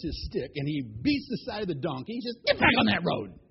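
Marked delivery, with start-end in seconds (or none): 2.5-3.4 loud, angry command at a wayward animal; acted outburst of annoyance; angry order. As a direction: order the donkey back, loud and cross. Balaam beats the straying donkey.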